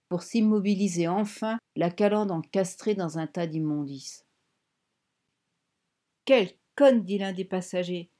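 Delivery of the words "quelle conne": loud, raised-pitch exasperation; angry insult. A narrator's reading. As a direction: spit out the insult at full voice. Passenger insults her after crash. (insulte exaspérée, lancée fort)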